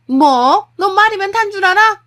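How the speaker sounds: high, very loud mother-in-law outburst; furious yelling. Acted. Mother-in-law answering daughter-in-law's retort, furious yelling. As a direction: yell as if the throat would tear. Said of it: "소리를 고래고래 목청이 떨어지도록 지르시길래".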